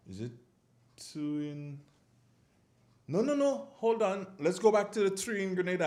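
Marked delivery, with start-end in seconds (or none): 3.1-5.8 sudden self-correction. Real, not acted. Host catches his own counting.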